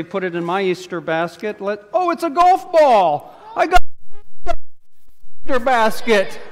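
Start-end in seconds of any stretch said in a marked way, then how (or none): 1.9-6.4 excited discovery. Acted. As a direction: show the kids a thrilling surprise find. Surprise find in Easter basket.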